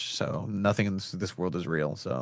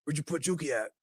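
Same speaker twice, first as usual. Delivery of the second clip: Danny Trejo impression; 5 semitones higher than usual. Actor quizzes coach about a missing player, put-on impression. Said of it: "There's Danny Trejo interviewing fake Mikey Varas".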